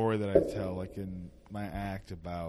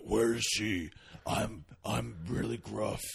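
gruff put-on voice. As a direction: play a gruff guy pushing his voice down. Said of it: "I'm really gruff. I'm gonna lower my voice".

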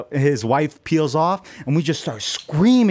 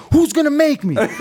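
high, loud laughing challenge; mock tough-guy taunt; defiant; 11 semitones higher, 4 dB louder than usual. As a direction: dare him, laughing. Defiant retort to bouncer.

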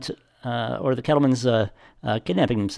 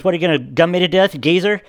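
higher, louder, quick mock challenge; retold confrontational taunt; 7 semitones higher, 5 dB louder than usual. Lawyer confronting an old parking attendant, retold taunt. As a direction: imitate a fed-up man goading an old-timer, pitched up. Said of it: "gets out and says".

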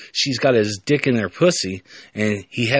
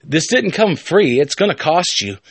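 sly professor voice; 5 semitones higher, 3 dB louder than usual. Acted. Professor demands payment.

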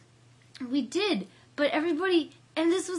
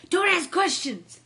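higher and much louder, insisting firmly; imitating a friend hustling her out the door; urgent order; 6 semitones higher, 12 dB louder than usual. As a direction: bark it out like there's no time to explain. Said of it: "And she's like".

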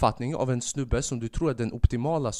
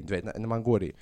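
ordinary talk, nothing marked

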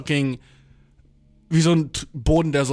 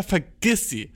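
normal talking voice

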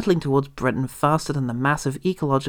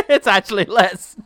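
laughing; 8 semitones higher, 7 dB louder than usual. Pretend. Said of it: "That's his catchphrase".

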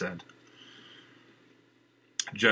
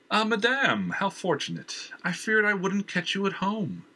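higher, smooth villain's voice; a charming, pushy villain at the door; Jenner's voice; 11 semitones higher than usual. Acted. Jenner oozes false charm at Valerie's door.

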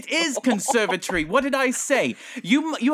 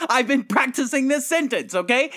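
higher-pitched, defensive protest; indignant professor; 6 semitones higher, 3 dB louder than usual. Acted. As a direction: justify yourself in a huffy accented professor voice. Professor indignantly defends his poor reading.

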